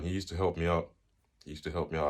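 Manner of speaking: ordinary delivery, nothing marked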